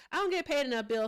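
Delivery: protesting to a chat viewer, high and playful; playful complaint. Real, not acted. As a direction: whine it in jest to Bill.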